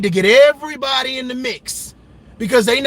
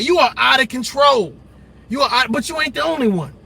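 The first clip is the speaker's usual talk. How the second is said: usual speaking voice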